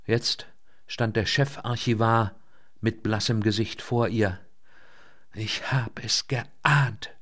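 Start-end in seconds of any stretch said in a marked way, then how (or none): 5.3-7.1 slow, drawn-out groan of dismay. A narrator's reading. Chief archivist, pale, to Elke Picoleit, groaning. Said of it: "stöhnte er".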